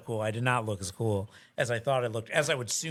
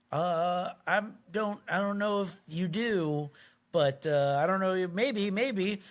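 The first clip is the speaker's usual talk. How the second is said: unsure, halting reply, pitched up; retold awkward uncertainty; hesitant; 5 semitones higher, slower than usual. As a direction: stammer it out, noncommittal. Unsure reply to confronting stranger.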